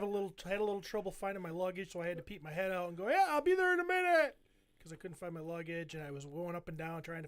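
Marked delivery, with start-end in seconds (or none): 3.1-4.4 high, raised call to someone waiting outside; called out high and loud. Retold or imitated. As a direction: holler it over to your ride. Calling out to his waiting driver.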